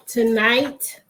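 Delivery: ordinary talk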